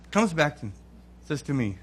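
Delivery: ordinary talk, nothing marked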